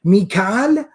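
Mikal as two long syllables, mee-KAAL; Hijazi pronunciation. Shown as a demonstration. Teacher naming the Hijazi form, Mikal said mee-KAAL. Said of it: "was the pronunciation according".

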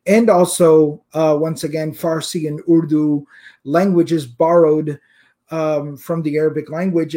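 usual speaking voice

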